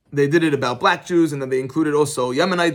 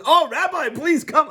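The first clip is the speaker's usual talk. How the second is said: eager doctor; 10 semitones higher, slower than usual. Retold or imitated. Doctor calling the rabbi over.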